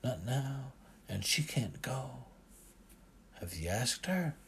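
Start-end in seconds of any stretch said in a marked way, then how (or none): none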